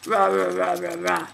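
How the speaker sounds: loud rah chops; forceful chopping noises; chopping sounds. Acted. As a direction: chop with each rah. Axe chopping wolf.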